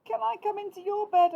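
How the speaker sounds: high, loud, quick little mouse plea; Little Mouse pleading. A narrator's reading. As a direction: beg like a tiny mouse. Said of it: "asked Little Mouse".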